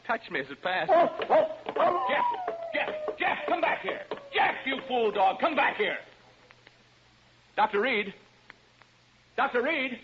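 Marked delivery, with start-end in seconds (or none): 0.9-6.0 loud high shouting after a runaway dog; calling the dog. Acted. 7.6-10.0 loud high shout across the house; calling out. Acted. Calling colleague from another room.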